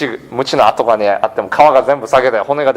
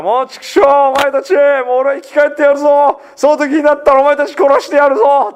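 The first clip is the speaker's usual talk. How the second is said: much higher, hammed-up furious threat; vengeful retort; 11 semitones higher, faster than usual. Preacher imagining Jesus cursing his killers, mock furious. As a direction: spit out a mock revenge threat from the cross. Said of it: "私だったらそんなこと言ってるかなと思います".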